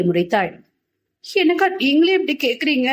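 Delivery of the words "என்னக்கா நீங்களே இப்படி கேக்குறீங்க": high, hurt, weepy voice; tearful. A narrator's reading. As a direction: plead with big sister through tears. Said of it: "கண்ணீர் சிந்தினாள் தூரிகை".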